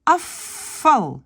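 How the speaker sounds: Afval with the V said as F; Dutch assimilation. Shown as a demonstration. Teacher models Afval, V turned to F. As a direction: say Afval like afal, no voiced V. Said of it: "le V devient F".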